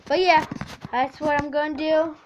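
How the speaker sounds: usual speaking voice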